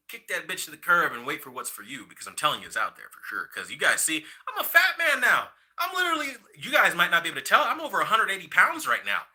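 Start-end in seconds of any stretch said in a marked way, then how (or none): 4.5-6.3 high, laughing through the words. Real, not acted. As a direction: laugh it out, much higher. Laughing about his weight gain.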